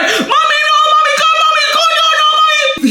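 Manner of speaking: very high, frantic mock wail. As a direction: imitate a driver begging in panic. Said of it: "He's, he's panicking".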